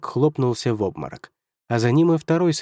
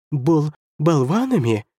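slow, high squeal of offence; stutter, then squeal it in outrage; shrill exclamation; 4 semitones higher, slower than usual. A narrator's reading. First counselor hears himself called a blockhead, squealing shrilly. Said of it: "визгливо воскликнул первый советник".

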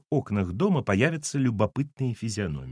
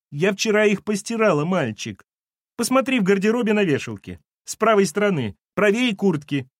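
mild housekeeper voice; 9 semitones higher than usual. A narrator's reading. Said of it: "провсхлипывала Валентина".

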